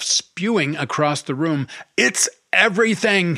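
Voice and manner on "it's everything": high, loud scream. A narrator's reading. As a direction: hurl it at her, furious. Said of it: "he screamed at her".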